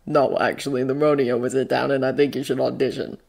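low, soft, dry joke; Bucky, deadpan teasing. Acted. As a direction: tease him quietly, keeping the voice down.

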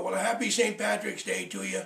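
ordinary delivery

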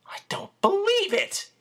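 whispered in disbelief, pitched high; breathed in awe. A narrator's reading. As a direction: breathe it out, amazed. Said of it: "Chris breathed".

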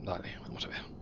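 soft unvoiced whisper. Real, not acted. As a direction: whisper it to yourself. Whispered to self.

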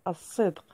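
الصدق said assidq, lam dropped, sad doubled; lam silent. Shown as a demonstration. Teacher demonstrating the sun letter to pupils, assidq with doubled sad. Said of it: "عفوًا، ولا تنطق".

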